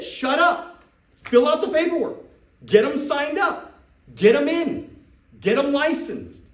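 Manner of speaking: high, loud, punchy orders; emphatic commands. Real, not acted. Coach drilling sales reps on closing, high and loud. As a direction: bark each step like a drill. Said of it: "coach hammering instructions".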